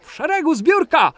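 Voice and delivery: loud, high barked order like a PE teacher; gym teacher command. Retold or imitated. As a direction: call the line-up like a teacher on the sports field. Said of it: "kiedy pan nauczyciel mówi do nas". (głośna, wysoka komenda nauczyciela WF-u)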